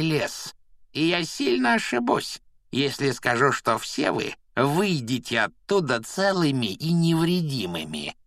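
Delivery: sneering, high-pitched jeering caretaker; mocking. A narrator's reading. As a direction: taunt the boy with nasty glee. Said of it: "Филч издевательски произнёс".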